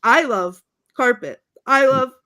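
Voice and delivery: loud, halting dimwit declarations; pause, then blurt each object out; Brick. Retold or imitated. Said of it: "And Brick says".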